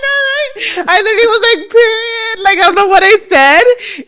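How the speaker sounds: high, laughing words. Real, not acted. Recalling her reply to the fan mom, high laughing. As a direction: break into laughter mid-sentence.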